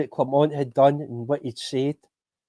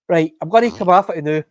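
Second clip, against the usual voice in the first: ordinary talk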